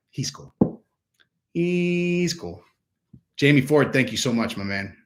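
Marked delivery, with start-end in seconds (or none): 0.1-0.5 softly imitated approval, tossed off. Quoting a woman won over by the video, said softly. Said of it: "She's like". 1.5-2.6 higher, drawn-out mock approval; imitated approval. Quoting a woman won over by the video, drawn out higher. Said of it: "She's like".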